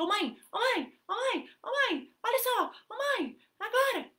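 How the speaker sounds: put-on high, insistent nagging kid voice; demanding child. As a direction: nag like an impatient toddler. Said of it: "que fica".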